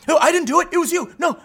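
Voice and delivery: high, loud hammed-up whiny denial; mock panicked denial. Embarrassed classmate denying the fart, high panicky protest. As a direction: play a flustered kid blaming someone else.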